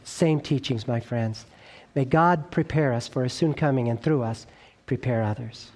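ordinary delivery

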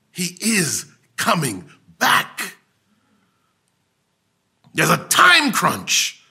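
full-force preaching. Acted. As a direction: preach it out to the church.

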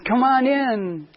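high, slow, beaming welcome; vivacious young lady welcoming a visitor; bubbly greeting. Retold or imitated. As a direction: greet him with a big smile in your voice. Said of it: "smiles a big smile and says".

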